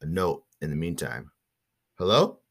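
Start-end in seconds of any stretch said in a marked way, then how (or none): 2.0-2.4 a louder, rising hello called toward the background; calling out. Real, not acted. Host calls out mid-show to someone who isn't there, rising hello. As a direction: call out hello as if someone is in the next room. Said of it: "Why did I say hello like someone was in the background".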